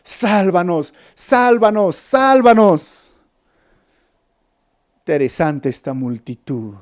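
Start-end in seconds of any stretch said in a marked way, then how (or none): 0.0-3.1 rising from plain to higher, louder, slow cry; growing chant, ending drawn out and louder; building plea. Retold or imitated. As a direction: build each sálvanos into a crowd's plea. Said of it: "y decían".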